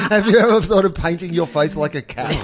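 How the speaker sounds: teasing question through a laugh; joking with friends, laughing mock question; laughing tease. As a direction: giggle it out as a joke.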